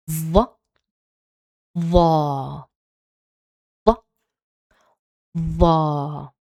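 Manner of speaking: ظ drilled with short and long vowels; letter with vowels. Shown as a demonstration. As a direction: sound out ظا ظو ظي one by one. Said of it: "pronounce it now with the long and short vowels".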